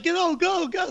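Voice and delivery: high, panicked mock yell; retold frantic shriek; imitated screaming. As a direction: act out friends frantically urging the driver. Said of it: "They're screaming".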